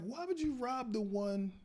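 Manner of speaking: high, slow exasperated demand; indignant at the friend; getting mad. Retold or imitated. Employee mad his own store got robbed, high and exasperated. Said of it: "part of me was getting mad".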